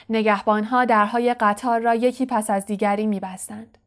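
plain reading voice

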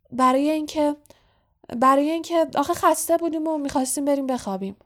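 high nervous stumble, then a rush; falter, restart, then hurry out the excuse; stammering. A narrator's reading. Harry making an excuse to Snape, nervous stammer. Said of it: "به لکنت افتاد".

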